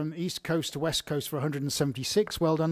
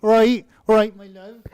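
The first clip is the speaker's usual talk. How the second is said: louder English greeting that fades on the last words; English accent; 7 semitones higher, 10 dB louder than usual. Shown as a demonstration. Showing a viewer his English accent, with more gusto. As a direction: give her the full English accent, punchy start. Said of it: "she loves our accents".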